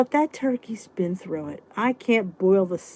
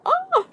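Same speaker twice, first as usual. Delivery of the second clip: delighted squeal. Retold or imitated. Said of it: "I'm like".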